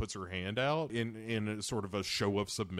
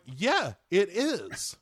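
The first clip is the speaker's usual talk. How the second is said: louder, higher, exasperated agreement; emphatic agreement; 7 semitones higher, 3 dB louder, much slower than usual. Retold or imitated. Viewer answering the movie hero, exasperated emphasis. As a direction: land it as an obvious, damning yes. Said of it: "And you're like".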